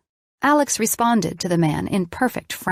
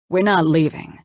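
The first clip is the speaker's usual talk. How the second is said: authoritative. A narrator's reading. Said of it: "He spoke as if he owned the place".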